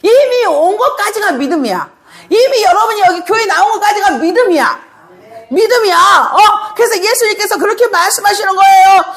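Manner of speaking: ordinary talk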